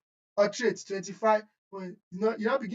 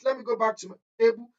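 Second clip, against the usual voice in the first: normal talking voice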